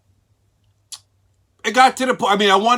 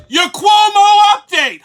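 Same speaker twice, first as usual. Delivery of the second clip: announcer shout; 10 dB louder, slower than usual. Acted. Host announces new show segment.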